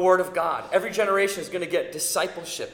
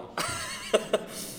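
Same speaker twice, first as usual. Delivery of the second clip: soft laugh; 2 semitones higher, 7 dB quieter than usual. Real, not acted. Laughs at own retort.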